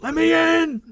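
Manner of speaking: high screeching shout; mock demonic shriek. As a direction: shriek it like a possessed ghoul. Mock demonic shout of Tracer line.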